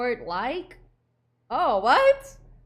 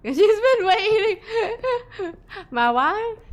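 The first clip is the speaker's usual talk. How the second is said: laugh-crying; 7 semitones higher, 7 dB louder, slower than usual. Acted. Echoes narrator, laughing and crying.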